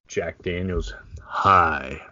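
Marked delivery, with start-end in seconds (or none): 1.2-2.0 low opening greeting for an ad; mock spokesman saying hello. Brother mocking up a whiskey ad, ad spokesman. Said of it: "if we get an advertisement with".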